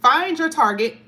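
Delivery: proclaiming the card title; card title called out. Acted. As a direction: call out the card's name. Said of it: "it literally says".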